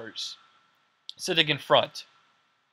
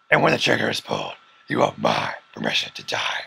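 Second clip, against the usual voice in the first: çok daha alçak, boğuk Batman taklidi; cümleyi derin Batman sesiyle söyle. Retold or imitated. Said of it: "loses his Batman voice".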